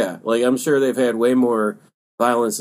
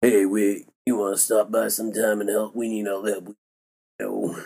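lazy mumble fading softer; trailing off; 3 semitones lower, 5 dB quieter than usual. Retold or imitated. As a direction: let the words slur and die away. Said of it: "you're just trailing off again".

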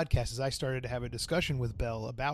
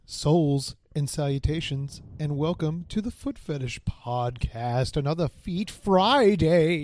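slow showman intro building louder; slow announcer intro; 5 dB louder, slower than usual. Acted. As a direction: announce it like a show host.

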